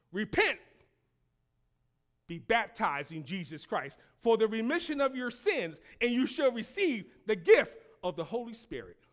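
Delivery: forceful pulpit quote; preached proclamation. Real, not acted. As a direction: proclaim the command to the congregation. Proclaims Peter's answer.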